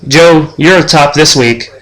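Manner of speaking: usual speaking voice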